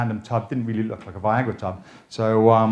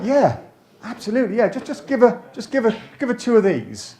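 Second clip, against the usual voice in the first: high, louder, excited yes; eager agreement; 9 semitones higher, 4 dB louder than usual. Acted. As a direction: jump on the request with mock eagerness. Acts eager reply to husband's request.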